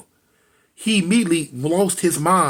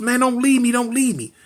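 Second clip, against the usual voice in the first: higher-pitched mild pleading; retold tearful plea; 7 semitones higher than usual. Brother telling his dying sister, acted-out plea. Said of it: "and he crying".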